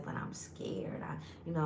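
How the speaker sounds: whispered, imitating a nervous friend; scared whisper. Friend confessing fear of her first date, whispered. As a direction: breathe it out like a frightened confession.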